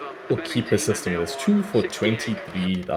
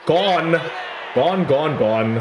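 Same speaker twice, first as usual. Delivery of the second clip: higher, louder, repeated cry; excited shout; 7 semitones higher, 4 dB louder, much slower than usual. Real, not acted. Gamer celebrating a wicket, loud excited shout. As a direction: yell it in triumph.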